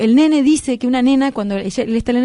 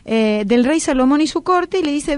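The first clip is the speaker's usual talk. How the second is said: usual speaking voice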